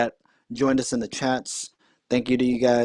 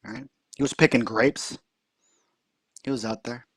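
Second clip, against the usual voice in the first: ordinary talk, nothing marked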